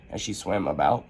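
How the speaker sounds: plain reading voice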